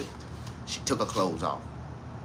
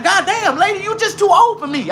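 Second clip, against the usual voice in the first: high, loud shout through laughter; hammed-up laughing yell; 5 dB louder than usual. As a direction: yell it while cracking up. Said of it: "I'm like".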